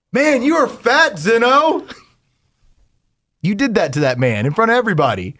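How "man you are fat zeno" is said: high, slow teasing jeer; mock taunt. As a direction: drag it out to mock him. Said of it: "you went like this".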